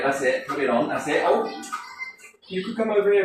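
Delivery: ordinary talk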